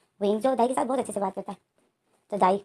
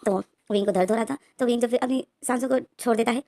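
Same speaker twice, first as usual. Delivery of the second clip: usual speaking voice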